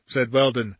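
plain reading voice

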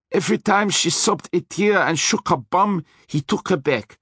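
menacing Austrian driver, high; threatening Austrian voice; Austrian accent. A narrator's reading. Hector continues.

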